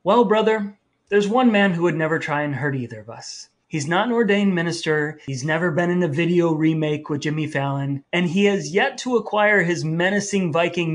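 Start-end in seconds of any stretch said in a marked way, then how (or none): none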